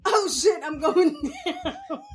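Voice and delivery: mock panic, half laughing. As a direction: retell the fall laughing. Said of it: "That's what I heard in my head".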